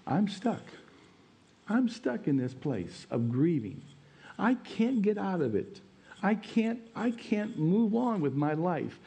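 high, pleading cry of someone stuck in grief; voicing a grieving person. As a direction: plead like someone who cannot move on. Preacher voices grieving person.

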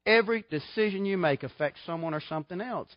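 ordinary talk

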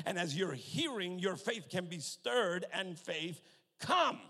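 loud, fervent pulpit delivery; full-force preaching. Real, not acted. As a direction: drive it home to the congregation, punching key words.

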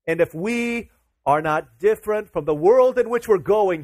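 emphatic sermon delivery; fervent preaching. Acted. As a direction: press the point hard like a revival preacher. Preacher pressing his point.

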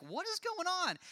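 high-pitched, incredulous question; baffled disbelief. Acted. David baffled by cowering army.

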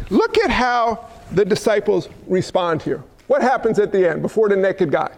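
ordinary talk

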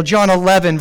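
normal talking voice

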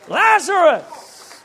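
roaring the name across the tomb; booming call. Acted. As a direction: summon the dead with full power. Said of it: "when he spoke, even death could not hold him back".

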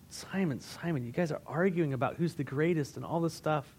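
soft, let-down reproach; disappointed. Acted. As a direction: play a teacher quietly disappointed in his student. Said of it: "most likely showing disappointment".

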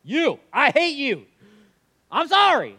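high, loud, slow hammed-up hateful confession; mock outburst. Churchgoer blurting hate at pastor, hammed-up loud high confession. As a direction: overact a sudden hateful confession then apology. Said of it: "if one of you stood up right now and were like".

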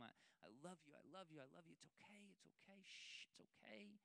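put-on soft, hushed comforting of a crying child; calm a sick little boy back to sleep; soothing hush. Father soothing crying son at night.

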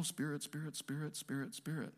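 playful spooky hush. Pretend. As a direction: tease it like a ghost story. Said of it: "this may seem kind of spooky and mysterious".

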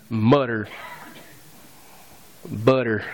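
the word mutter said with a light Southern twang. Shown as a demonstration. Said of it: "You had a little twang to a mutter".

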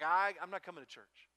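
put-on loud, defiant teen refusal; mock teenage protest, loud; kid's loud refusal. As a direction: push back hard like a stubborn kid. Kid refusing loudly in story.

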